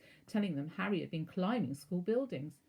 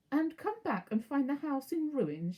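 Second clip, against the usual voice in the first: put-on higher, louder snarl at the boy; 8 semitones higher, 5 dB louder than usual. A narrator's reading. Aunt rejecting Harry staying home, snarling higher and louder. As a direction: spit it out like a threat. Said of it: "she snarled".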